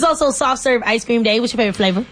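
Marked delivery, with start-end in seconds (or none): none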